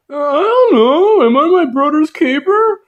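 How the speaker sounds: high, loud, hammed-up innocent Cain; mock Cain. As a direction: play dumb in a squeaky high voice. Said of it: "Cain's all like".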